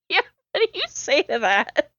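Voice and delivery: amused, giggly aside; laughing. Real, not acted. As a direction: talk through real laughter. Still laughing at the line.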